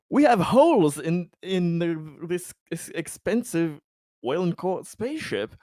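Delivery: loud, high outraged complaint; exasperated scolding. Acted. Officer scolding crew for gunfire aboard ship, exasperated.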